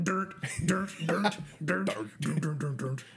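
"[name]" repeated as a rhythmic bass line; bass note imitation. Shown as a demonstration. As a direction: play "[name]" like a bass riff. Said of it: "sounds like hitting a bass".

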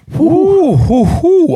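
high, loud whooping cheer; excited whoop. Real, not acted. Hyping the intro just heard.

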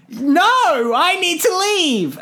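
high, put-on voice stretching the words; mock alarmed refusal. Retold date turns down dying man, mock alarm.

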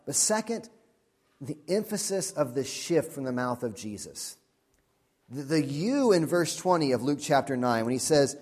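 normal talking voice

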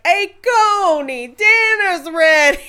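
high, slow calling-out yell; yelling across the room. Retold or imitated. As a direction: call him in from outside, drawn out. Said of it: "picturing him just, leaning out of the hut".

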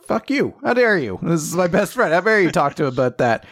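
high-pitched, indignant retort; mock indignation. Host voicing Asta defending his best friend, mock indignation. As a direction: play at being outraged on a friend's behalf.

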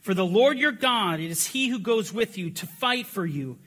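loud, high rallying proclamation; preacher rousing the room, loud and high; battle cry reading. Real, not acted. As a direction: call the congregation to battle. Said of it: "We are gonna do our battle cry".

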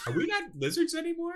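high-pitched disbelieving question; high incredulous question. Real, not acted. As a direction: squeak it in disbelief. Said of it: "you're totally right".

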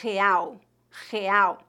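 "real" as HE-AU, the R breathed in the throat like an H and the final L like U; Portuguese pronunciation. Shown as a demonstration. Teacher models the Portuguese word for learners to repeat, throaty Portuguese R. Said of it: "mais ou menos como a letra H no inglês".

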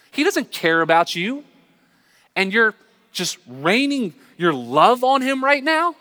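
high, strained, indignant complaint at God; furious prayer. Retold or imitated. Teen in worship service complaining to God about his friend, furious and high-pitched. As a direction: pitch it way up and protest, outraged. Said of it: "and I got furious".

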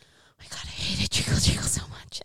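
hushed, breathy disdain; whispered disgust. Real, not acted. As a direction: whisper it like a guilty confession. Hating on Jingle Jangle.

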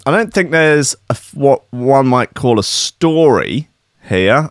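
ordinary talk, nothing marked

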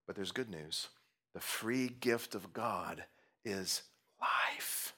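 usual speaking voice

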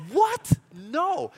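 startled retold no; shocked what. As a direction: squeal the what in mock outrage. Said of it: "And I'm like".

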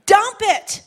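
high, loud call to dump it; firm command. Acted. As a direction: drive it home to the crowd. Preacher urging, then repeats louder.

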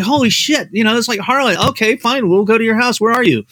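put-on a shocked young writer agreeing at once; high, startled retelling of his own reply; stunned, giving in. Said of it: "I'm like".

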